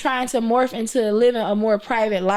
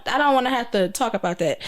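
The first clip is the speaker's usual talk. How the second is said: usual speaking voice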